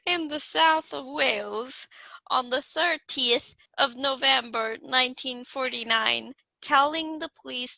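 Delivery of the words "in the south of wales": hushed whisper. Pretend. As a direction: breathe it out in a whisper. Whispered after being teased.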